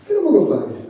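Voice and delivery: pharmacy man. Retold or imitated. Pharmacy man in broken Italian.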